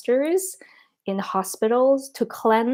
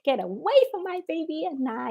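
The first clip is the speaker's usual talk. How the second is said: high-pitched reenacted yell; high, protective mock shout; retold yell; 9 semitones higher than usual. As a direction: act out yelling someone away from your baby. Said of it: "I yelled at him so loud".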